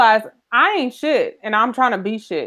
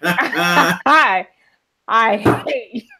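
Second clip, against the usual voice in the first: ordinary talk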